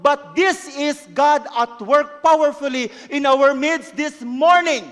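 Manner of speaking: loud, high proclamation; preaching at full force. Acted. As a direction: declare it with conviction. Preaching climax over music.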